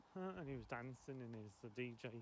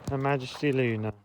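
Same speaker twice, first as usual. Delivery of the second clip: loud mock-royal call to the dog; 22 dB louder than usual. Real, not acted. Owner calling loudly to her dog Luna.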